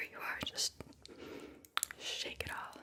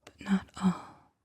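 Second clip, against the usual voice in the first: whispered poem reading. Acted. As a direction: whisper the poem phrase by phrase, letting each one settle. Reads a third poem aloud.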